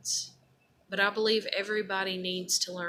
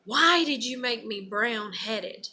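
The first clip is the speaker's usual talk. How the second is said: raised, louder cry of anger; angry wail; angry scream to God; 3 semitones higher, 6 dB louder than usual. Pretend. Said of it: "scream in anger to God".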